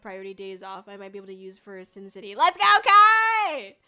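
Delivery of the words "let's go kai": drawn-out, much louder hype cheer; stretched-out shout of support; excited cheer. Real, not acted. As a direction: cheer them on at full volume. Cheering a chatter's news.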